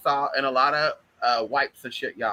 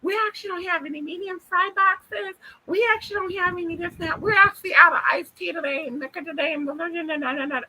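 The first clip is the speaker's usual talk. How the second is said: fast food cashier voice; 13 semitones higher than usual. Retold or imitated. Vlogger mocks a drive-thru cashier, high chirpy voice. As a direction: mimic a chirpy drive-thru worker listing what's out. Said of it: "McDonald's, like".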